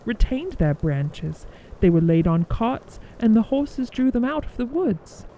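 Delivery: ordinary delivery, nothing marked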